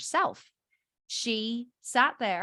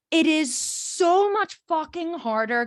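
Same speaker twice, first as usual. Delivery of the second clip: loud, high, slow emphatic punch; real heartfelt emphasis, drawn out; 7 semitones higher, 6 dB louder, slower than usual. Real, not acted. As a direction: drive every word home forcefully. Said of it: "helps me to be emphatic".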